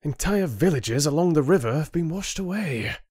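high-pitched, urgent, contained anger; suppressed anger. A narrator's reading. Rin presenting evidence to the council, suppressed anger. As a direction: press the point without exploding. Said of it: "keep the anger out of his voice".

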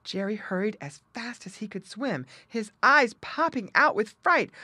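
usual speaking voice

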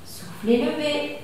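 normal talking voice

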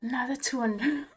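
hushed whisper. Real, not acted. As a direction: whisper it in awe. Whispering at slot wins.

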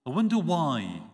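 ordinary talk

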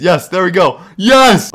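sudden victorious yell; excited cheer. Real, not acted. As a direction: cheer like the prank just landed. Trap finally works, cheering.

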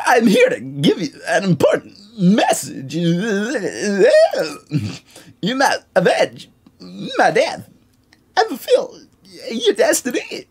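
high wavering ghost voice; quavering spirit delivering a prophecy; ghostly prophet voice. Acted.

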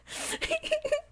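shrill giggle, very high; high squealing laugh. Real, not acted. Streamer laughing at her own joke, high squeal.

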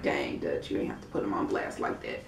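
low, soft under-the-breath aside to Duchess; hushed aside. Retold or imitated. Telling Duchess in a low hush she was harsh. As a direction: mutter it low so only she hears. Speaker reacts to Duchess's jab.

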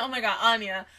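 usual speaking voice

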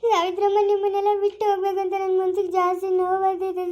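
tearful, wailing gratitude; crying farewell. Acted. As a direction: sob through a painful goodbye. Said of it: "ಅಳ್ಬಾರ್ದು, ಸುಮ್ನೆ ಇರು ಮಗಳೇ". (ಅಳುತ್ತಾ ನೋವಿನಿಂದ)

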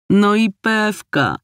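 put-on two young sisters answering as one, teasing; twin sisters in chorus. A narrator's reading. Twin sisters teasing their big sister, in chorus. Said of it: "odpowiedziały zgodnym chórem".

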